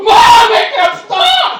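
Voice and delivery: put-on high, loud, slow wailing kid; frightened child. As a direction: wail like a scared little kid. Scared child in bit.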